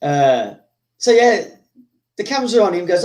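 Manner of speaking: ordinary talk, nothing marked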